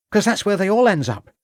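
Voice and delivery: high-pitched country lad; servant boy. Acted. Boy explains himself to the inspector, high and insistent. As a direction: answer back in a high boyish voice.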